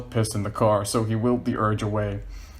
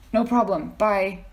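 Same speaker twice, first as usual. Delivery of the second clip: lady's voice; 10 semitones higher, slower than usual. Acted.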